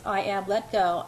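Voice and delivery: ordinary delivery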